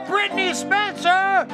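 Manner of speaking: normal talking voice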